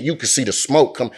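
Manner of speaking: usual speaking voice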